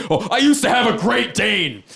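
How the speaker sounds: high, loud mock snob; snobby big guy. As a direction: sniff at the dog, hammed-up. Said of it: "big guy there being".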